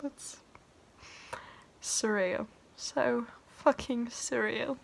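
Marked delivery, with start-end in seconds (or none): none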